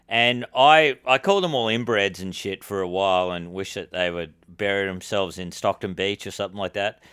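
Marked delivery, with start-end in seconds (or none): none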